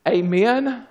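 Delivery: ordinary delivery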